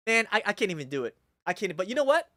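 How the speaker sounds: ordinary delivery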